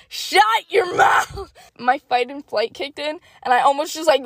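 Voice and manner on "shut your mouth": mock outrage. Narrator mock-yelling at the employee who jinxed her. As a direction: bark it playfully at the jinxer.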